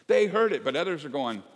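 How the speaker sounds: loud, high, driving sermon delivery; full-force preaching. Acted. Pastor rousing the congregation, preaching hard and loud. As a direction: preach it out to the whole room.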